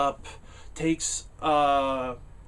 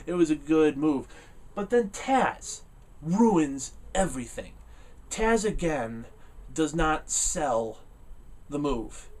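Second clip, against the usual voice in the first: ordinary delivery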